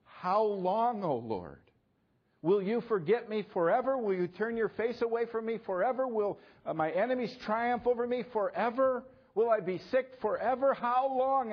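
high, loud anguished plea to God; pleading cry. Retold or imitated. Preacher voicing the psalmist crying to God, anguished plea. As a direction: cry out to the Lord in desperation. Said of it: "the psalmist cries out".

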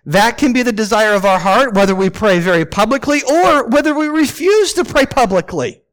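ordinary talk

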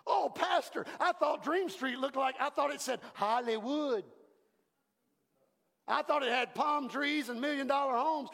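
high, naive questioning voice; lightly voiced puzzled listener; mock congregant. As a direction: play the disappointed churchgoer. Congregant quoted objecting to pastor.

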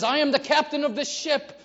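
captain shouting threats with a laugh; furious captain. Retold or imitated. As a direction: bark orders as an enraged captain. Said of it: "And he says".

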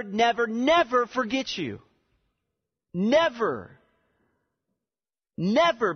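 emphatic preaching. Real, not acted. Preacher assuring the congregation God won't forget them, impassioned emphatic preaching. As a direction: hammer each repeated "never" high and hard. Preacher drives home the point.

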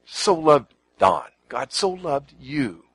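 slow, leaning hard on so; stress on so. Shown as a demonstration. Preacher telling the congregation God so loved them, stressing so. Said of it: "I just emphasize that God so loved".

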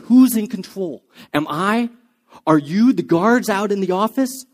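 loud, threatening challenge in his face; menacing shout. Retold or imitated. Hopkins to the psychiatrist in a headlock, loud and menacing. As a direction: bark it at him like a man holding a pen to his throat. Hopkins grabs him, pen to neck.

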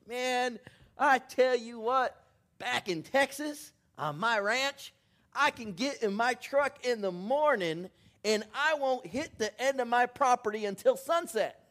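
high, loud boastful Texan rancher; big Texas cattleman. Retold or imitated. Texas rancher bragging to the Wisconsin farmer, loud and boastful. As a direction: brag like a big old cattleman about his land. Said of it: "this big old cattleman".

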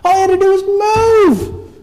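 high, loud crying shout; frustrated cry. Retold or imitated. Child watching the horse sink, crying shout. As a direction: wail it like a heartbroken child.

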